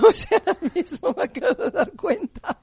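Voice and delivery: mock self-deprecation broken up by laughter; laughing. Real, not acted. Host admits to the guest what real English sounds like, laughing.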